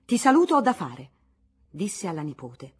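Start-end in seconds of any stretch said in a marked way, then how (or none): 0.0-1.1 curt goodbye. Acted. Aunt leaving in a huff.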